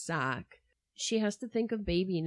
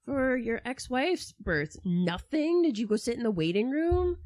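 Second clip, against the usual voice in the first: sarcastic retort; 5 semitones higher, 3 dB louder than usual. Retold or imitated. Speaker mocking Castro, sarcastic.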